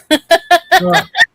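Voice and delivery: short high giggle. Real, not acted. Host laughing about making the guys dance in AI, high giggle.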